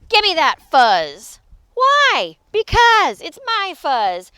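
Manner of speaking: loud, indignant demand; snatch it with your voice, loudly; childish tug-of-war argument; squabbling. Acted. Quarrel over the fuzz.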